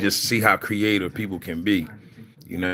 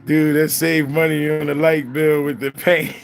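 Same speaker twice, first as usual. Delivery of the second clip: laughing quote; 6 semitones higher, 4 dB louder than usual. Retold or imitated. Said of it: "He said".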